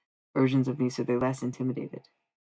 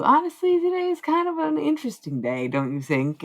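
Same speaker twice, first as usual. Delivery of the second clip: mock chatty small talk; 14 semitones higher, 5 dB louder than usual. Chatting up strangers, mock polite.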